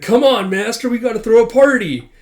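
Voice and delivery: high, loud, eager pupil; rally the master to celebrate; excited urging. Acted.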